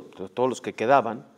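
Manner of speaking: ordinary delivery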